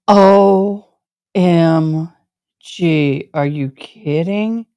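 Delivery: ordinary talk, nothing marked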